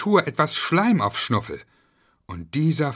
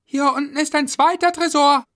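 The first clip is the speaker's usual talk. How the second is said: loud, high call from below; 8 dB louder than usual. Acted. Julia calls up to the others from below, loud and high. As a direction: shout it up the ladder. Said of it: "rief laut".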